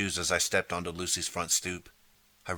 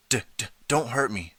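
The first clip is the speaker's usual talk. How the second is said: stuttering, slightly higher plea; frightened stammer; 4 semitones higher than usual. Acted. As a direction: stammer in fear, backing away. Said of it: "he cried".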